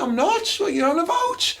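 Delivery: high protesting voice; mock indignant self. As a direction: play your startled younger self. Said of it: "I went".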